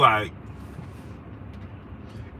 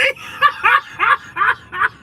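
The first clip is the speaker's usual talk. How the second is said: shouted laughter. Real, not acted. Cracking up at his own retelling.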